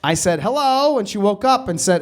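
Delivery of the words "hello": high, lilting hello; raised-pitch greeting, not shouted; calling out. Retold or imitated. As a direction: call to someone asleep, pitched up. Retelling his call to sleeping woman.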